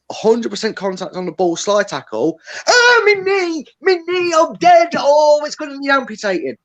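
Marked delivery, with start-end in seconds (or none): 2.7-6.6 high crying wail over a fake injury; mock agony wail. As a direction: ham up a diver's pained cry. Mocking a diving player's agony.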